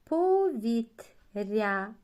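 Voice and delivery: Повітря slow and loud; slow model word; slow soft т before я. Shown as a demonstration. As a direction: draw out the softened т in Повітря. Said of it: "А тут що чуємо".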